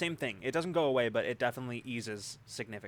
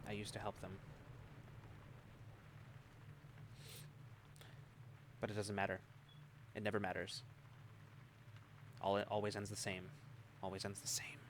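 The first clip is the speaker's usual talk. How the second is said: quiet repetitive mumbling that fades away; muttering; 2 semitones lower, 11 dB quieter, faster than usual. Acted. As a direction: mutter it softly, half to yourself. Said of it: "he's still muttering, repeating that".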